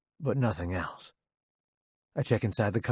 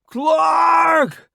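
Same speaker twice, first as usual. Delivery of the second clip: strained, high-pitched shout; long, loud yell of a name; calling out; 10 dB louder than usual. A narrator's reading. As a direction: cry out for help. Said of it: "Roddy calls out".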